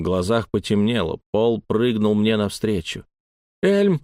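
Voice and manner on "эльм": high-pitched, narrated cry of alarm; moderately loud, high shout; woman's cry. A narrator's reading. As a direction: call out his name high and sharp. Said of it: "закричала Лайла".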